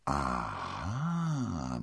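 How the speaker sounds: long, low, swooping aha; drawn-out bewildered sound, rising in the middle; confused murmur. A narrator's reading. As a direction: murmur it softly, puzzled. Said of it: "murmelte D'Artagnan verwirrt".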